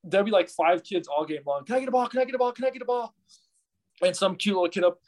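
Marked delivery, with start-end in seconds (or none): 1.6-3.1 put-on much higher nagging kid voice; demanding kid. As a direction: mimic a kid nagging over and over. Kids demanding, mimicked.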